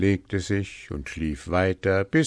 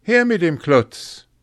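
loud, high impatient order; shouted demand; 11 semitones higher, 9 dB louder than usual. A narrator's reading. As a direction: demand it with a sudden yell. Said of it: "schrie Hans".

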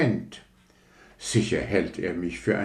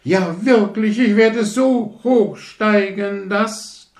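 prahlerische Rakete; 8 dB louder than usual. Acted. Rakete prahlt beim Aufsteigen.

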